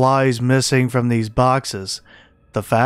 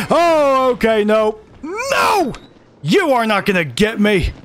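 high panicked yelps; screaming as it chases you; 9 semitones higher than usual. Real, not acted. Chased by a monster.

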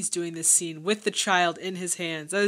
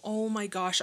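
ordinary talk

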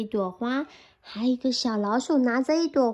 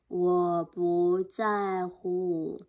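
very slow, low bear; sleepy bear; 5 semitones lower, much slower than usual. Acted. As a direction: drag it out half asleep. Bear refusing.

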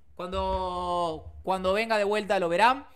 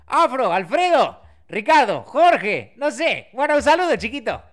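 put-on old Ricardo voice; 2 semitones higher, 7 dB louder than usual. Greeting the stream's viewers as an old man, lightly voiced. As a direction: ramble a loud greeting like a confused old man. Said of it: "mandá un saludo con la voz del viejo Ricardo".

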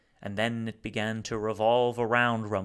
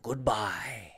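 whispered goodbye. Acted. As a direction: let it vanish in a whisper. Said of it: "Rumford's glottal tenor from the cocoon".

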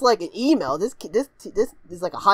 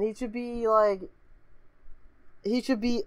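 ordinary talk, nothing marked

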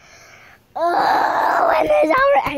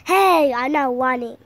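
ordinary talk, nothing marked

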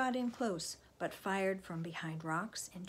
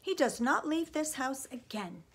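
raised, higher, emphatic order; firm forbidding; 6 semitones higher, 6 dB louder than usual. A narrator's reading. Mother forbidding Sam to go out, firm. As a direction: lay down the law loudly.

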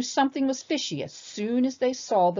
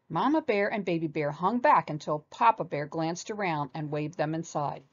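ordinary delivery, nothing marked

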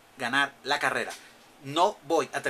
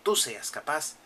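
soft, hushed storybook reading; softer dramatic narration of the game's words; reading game text; 2 semitones lower, 5 dB quieter than usual. Acted. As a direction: read the lore quietly and evenly.

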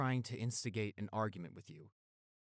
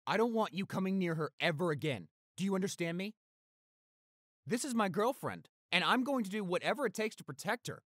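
angry threat; 10 semitones higher, 6 dB louder than usual. Acted. Threatening attacker to protect girlfriend.

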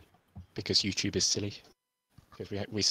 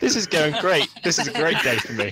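sarcastic; 7 semitones higher, 8 dB louder than usual. Real, not acted. Sarcastic about stream troubles.

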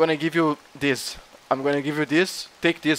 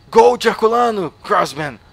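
excited cheer; 6 semitones higher, 6 dB louder than usual. Acted. Cheering on the new couple.

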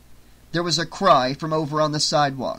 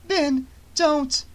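pleading cry; 12 semitones higher than usual. Acted. Pleading to stop gunman.

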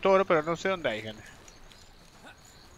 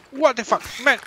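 high startled swear; shocked outburst; 9 semitones higher, 4 dB louder than usual. Real, not acted. Shocked by the ant's attack. (grosería de sorpresa, aguda)

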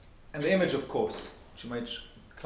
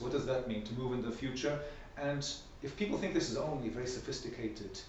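ordinary delivery

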